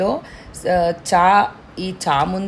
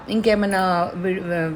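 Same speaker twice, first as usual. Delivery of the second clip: ordinary talk, nothing marked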